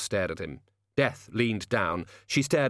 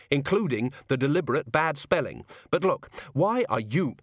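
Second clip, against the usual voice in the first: put-on higher-pitched young woman pointing things out; 5 semitones higher than usual.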